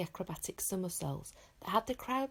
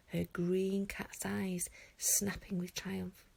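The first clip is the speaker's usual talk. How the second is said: ordinary delivery, nothing marked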